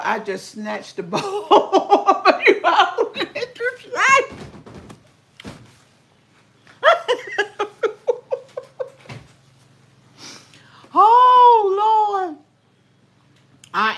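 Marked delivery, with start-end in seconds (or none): 1.2-8.9 high, slow words through real laughter; laughing. Real, not acted. Laughing at herself for snatching the bowl, giggling. Laughing at her own greed. 10.9-12.4 loud, high "Oh, Lord"; excited exclaim. Real, not acted. Reacting to her own antics, loud and high.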